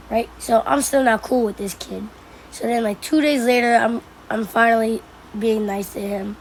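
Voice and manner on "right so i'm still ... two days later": usual speaking voice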